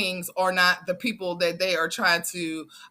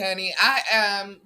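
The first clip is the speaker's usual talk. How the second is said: ordinary talk, nothing marked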